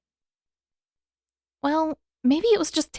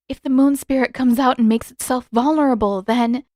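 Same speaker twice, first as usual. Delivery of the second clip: alarmed; 4 semitones higher, 6 dB louder than usual. Acted. Yue realizes danger, clutching arm.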